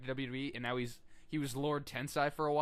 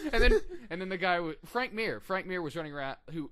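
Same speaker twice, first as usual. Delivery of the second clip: ordinary talk, nothing marked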